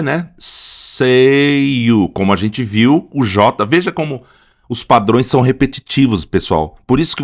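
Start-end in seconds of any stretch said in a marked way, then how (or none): 0.4-2.1 "Seio" with the E stretched long and higher; stress on E. Shown as a demonstration. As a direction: hold the E of "Seio" out, then close on "io". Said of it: "veja só a acentuação aqui no".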